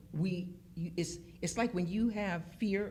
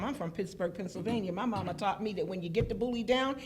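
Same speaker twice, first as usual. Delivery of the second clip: ordinary talk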